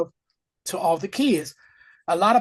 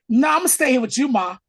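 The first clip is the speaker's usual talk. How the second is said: raised, higher, loud refusal; a boy refusing to leave his mother; clingy kid protest; 8 semitones higher, 7 dB louder than usual. Retold or imitated. Oz protesting to his mom that he stays, loud and high. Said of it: "Oz is like".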